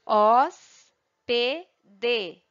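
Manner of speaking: three isolated beats, "os", "P", "D", with pauses between; split into syllables. Shown as a demonstration. Teacher showing pupils three different sounds, syllable by syllable. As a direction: sound it out slowly, one syllable at a time. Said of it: "são três sons diferenciados".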